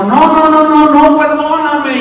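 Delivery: high, loud tearful cry of no. Acted. Wife sobbing to her husband for forgiveness, high loud tearful wail. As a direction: wail it, begging forgiveness through tears. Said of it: "y empezó a llorar".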